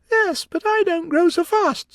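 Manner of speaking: put-on a high, pert young girl; Alice's voice. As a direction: answer back like a little girl. Alice retorting.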